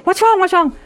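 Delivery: high, urgent calls from another room; alarmed husband calling. Retold or imitated. Husband hears a scream and calls from the next room, alarmed. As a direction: call out as if you heard a scream.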